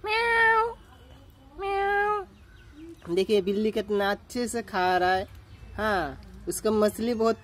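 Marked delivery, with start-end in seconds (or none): 0.0-3.1 high drawn-out meow, imitating a cat; mock cat meow. Vlogger calling the stray cat with a mock meow. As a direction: meow high and long like a kitten.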